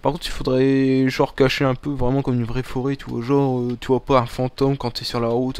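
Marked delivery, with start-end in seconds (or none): none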